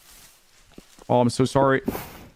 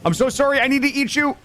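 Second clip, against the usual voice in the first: high, pleading apology; apologetic; 13 semitones higher, faster than usual. Pretend. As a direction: say sorry in a high voice. Apologizing to the deer he hunts.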